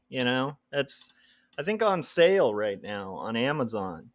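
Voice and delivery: ordinary talk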